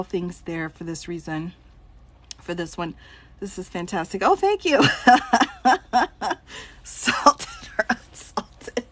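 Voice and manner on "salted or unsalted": laughing. Real, not acted. Joking question amid laughter.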